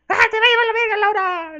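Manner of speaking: high, loud yelling threat at Laura; furious yell. Acted. Monkey yelling at Laura during the fight, furious shout. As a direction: scream the threat at full force.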